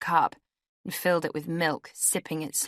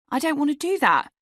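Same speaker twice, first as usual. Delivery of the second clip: high, louder panicked protest; 11 semitones higher, 5 dB louder than usual. A narrator's reading. Woman refusing the man in bed, panicked. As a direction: panic rising high, then dropping at the end. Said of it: "I said, panicked".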